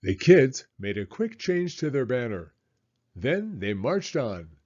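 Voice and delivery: ordinary delivery, nothing marked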